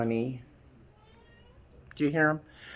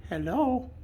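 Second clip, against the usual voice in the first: high pet voice; 11 semitones higher, 7 dB quieter than usual. Real, not acted. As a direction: coo it up high. Greeting pet.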